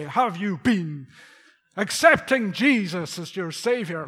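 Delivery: put-on loud, slow preacher with Canadian accent. Preacher reenacting visiting priest's sermon, loud Canadian accent. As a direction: boom it out as a Canadian evangelist. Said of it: "he had a Canadian accent".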